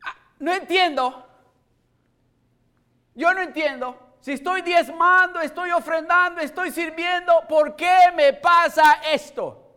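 high, loud cry of protest at God; retold angry prayer. Pastor reliving his quarrel with God, crying out loud. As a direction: shout your complaint to heaven. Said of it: "estas fueron mis primeras palabras".

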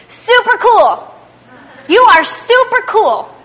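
put-on high, loud, drawn-out kid excitement; five-year-old's super cool. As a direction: copy an excited small child. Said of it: "the way my five-year-old says it".

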